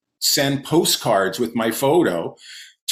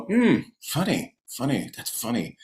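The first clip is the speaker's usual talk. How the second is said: unlaughing, muted appraisal, trailing softer; quiet, considered mock approval; intellectualizing comedian; 5 dB quieter than usual. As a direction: imitate a comic analysing a joke instead of laughing. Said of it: "comedians tend to intellectualize a joke".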